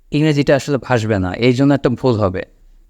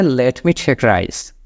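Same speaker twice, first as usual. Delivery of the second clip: slow model sentence; much slower than usual. Shown as a demonstration. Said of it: "ইংলিশে ওখানে বলতে হয়".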